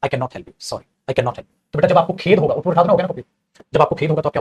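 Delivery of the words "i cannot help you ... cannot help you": slow, soft and lower, full of regret; mournful sorry, very slow; regretful sorry. Shown as a demonstration. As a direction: apologise slowly and softly. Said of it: "तब आप क्या बोलते हो".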